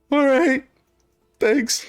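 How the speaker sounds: high-pitched, plaintive thanks; meek victim. Acted. Searched victim thanking the guard, high and meek.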